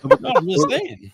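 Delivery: slow, high teasing; drawn-out high-pitched tease. Real, not acted.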